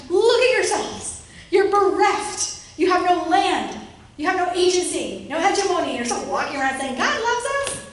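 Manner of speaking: a scornful jeer mocking a beaten people; mocking taunt. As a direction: sneer it down at the defeated. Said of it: "this taunting".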